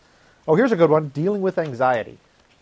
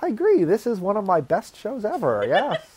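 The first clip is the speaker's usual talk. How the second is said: mock flattered reply; 7 semitones higher, slower than usual. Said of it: "And then I'd respond".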